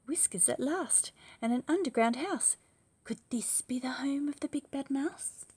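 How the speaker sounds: high loud voice of discovery; excited child. Acted. Gruffalo's child finding whiskers, high and excited. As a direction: gasp and hope it's the mouse.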